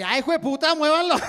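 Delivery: high-pitched mock angry yell; comic outburst from the impatient driver behind; grito impaciente actuado. Driver behind yelling at the polite pair. (grito agudo de conductor impaciente)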